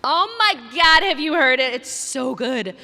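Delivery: loud, high excited gush. Real, not acted. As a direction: burst out with delight. Gushing over favorite band.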